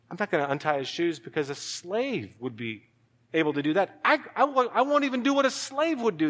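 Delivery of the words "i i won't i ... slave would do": high, urgent amazement; awed insistence. Acted. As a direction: pitch it up high, marvelling as you go. Preacher voices John's awe at Christ.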